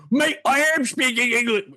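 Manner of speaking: very high mock yell; imitated indignant yelling. Imitating a riled Brit in an argument, mock yelling. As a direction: mimic a worked-up Brit yelling back. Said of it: "First of all, you're yelling".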